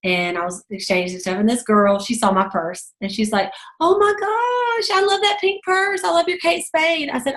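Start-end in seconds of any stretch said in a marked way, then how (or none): 3.8-7.1 high-pitched, drawn-out gush; gushing admiration. Retold or imitated. Store girl admiring a customer's purse, gushing. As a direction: imitate a delighted fan gushing.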